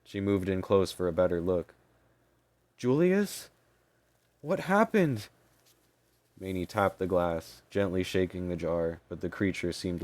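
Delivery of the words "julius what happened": high, horrified calling out. Acted. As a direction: call his name in horror. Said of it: "Maney's expression turned to horror".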